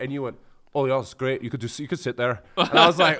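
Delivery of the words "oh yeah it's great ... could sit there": mock Scottish voice drifting Northern Irish; Scottish accent attempt. Host imitating his Scottish guest, slipping Irish accent. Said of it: "almost sounded Northern Irish there".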